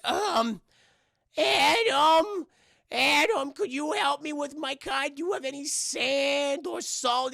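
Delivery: high, loud call turning into a plea; Whitey. Acted. Whitey calling Davey to help with his car, high pleading voice. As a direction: call out high, then ask sweetly and slowly. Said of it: "He goes".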